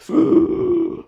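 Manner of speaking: a high, sudden gasp of impact; startled intake of breath; sharp gasp. Retold or imitated. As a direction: gasp as the bump lands. Landing the ladder bump.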